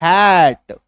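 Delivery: "hat" with a long open ae vowel; double-matra pronunciation. Shown as a demonstration. Teacher reading H-A-T for the class, stretched open "hat". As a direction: draw out the ae in "hat". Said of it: "double एकार की मात्रा".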